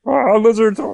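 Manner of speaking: mock fright. Friend shrieks at lizards in hammed-up fright. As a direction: yelp in hammed-up alarm.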